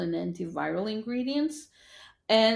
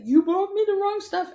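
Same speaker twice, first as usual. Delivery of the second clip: mock yell, much higher and louder; imitating angry dad; 13 semitones higher, 7 dB louder than usual. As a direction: replay dad's angry outburst. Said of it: "And he's yelling at me".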